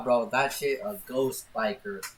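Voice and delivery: normal talking voice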